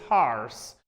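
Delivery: American HORSE with r sounded; American-accented HORSE, r before the s; American pronunciation. Shown as a demonstration. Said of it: "If it is American".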